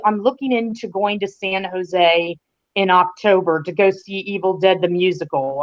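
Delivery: normal talking voice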